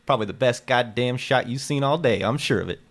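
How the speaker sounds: bragging. Acted. Lucky brags about his shot.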